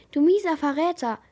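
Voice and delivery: loud, high, raging shout; furious roar. A narrator's reading. Crewman screaming at Jacob in rage, furious shout. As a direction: spit the insult out in fury. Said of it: "brüllte der Mann rasend vor Wut".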